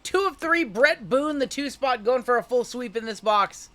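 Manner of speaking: high, loud hype announcement; excited. Real, not acted. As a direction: call it out like a big hit. Announcing big hit on stream.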